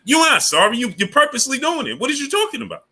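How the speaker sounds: high-pitched, exasperated rebuttal; indignant retort. Acted. Streamer rejecting the platform's apology for blocking viewers, indignant. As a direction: cut off the apology and call it out, pitch climbing.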